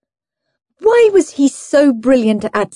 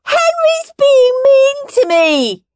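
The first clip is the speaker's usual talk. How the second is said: very high, slow wail; high-pitched tearful little-brother whine; wailing tattletale; 4 dB louder, slower than usual. A narrator's reading. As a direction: wail the complaint to mother. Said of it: "wailed Peter".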